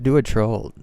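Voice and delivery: ordinary talk, nothing marked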